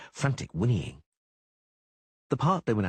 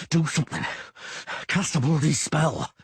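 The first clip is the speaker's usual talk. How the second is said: grunted; 6 semitones higher, 3 dB louder than usual. A narrator's reading. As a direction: force it out through strain. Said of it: "the Witcher grunted".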